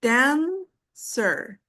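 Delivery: "dancer" drawn out, dan ... cer, with a gap between; syllable by syllable. Shown as a demonstration. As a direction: break "dancer" into two slow beats. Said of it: "two syllables".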